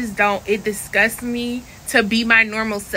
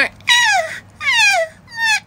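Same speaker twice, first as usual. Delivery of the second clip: very high whiny "ah" cries; whiny moans; 4 dB louder, much slower than usual.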